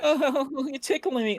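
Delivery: high squeaky cutesy voice; tickled little creature voice; mock tiger character. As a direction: play a giggly cartoon tiger. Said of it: "The little tiger and shit".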